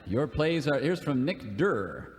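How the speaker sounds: normal talking voice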